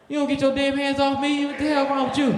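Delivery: high, outraged protest; indignant shock. Retold or imitated. Grabbed by a dancer in the club, high appalled protest. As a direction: squeal it out, appalled.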